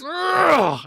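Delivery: sharp high grunt of effort; mock fight grunt. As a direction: let out a hard shouted grunt. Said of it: "He, like, goes".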